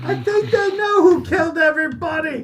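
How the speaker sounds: excited high yell; shouting. Acted. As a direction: yell it out in glee. Player shouting in character.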